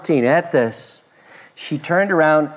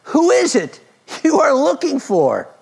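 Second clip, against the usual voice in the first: raised voice; 14 semitones higher, 5 dB louder than usual. Acted. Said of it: "And Jesus asked her".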